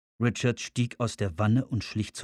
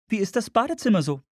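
hohe, schnelle Frauenstimme; Frauenfigur; 10 semitones higher, faster than usual. Acted. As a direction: frag hoch und zügig als Frau. Woman voiced in narration. (hohe, zügige Frauenstimme)

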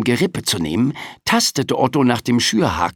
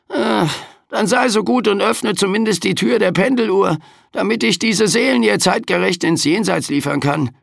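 high, sarcastic, weary Reaper; overworked Death complaining; grumpy Grim Reaper; 9 semitones higher than usual. A narrator's reading. Death keeps grumbling. (mürrischer Sensenmann)

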